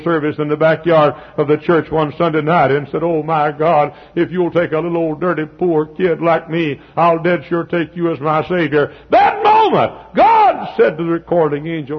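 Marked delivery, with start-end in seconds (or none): 3.0-11.0 louder, rising preacher cry. Real, not acted. Preacher retelling his boyhood vow to God, rising to a shout. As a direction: swear it out to God. Boy's prayer retold at climax.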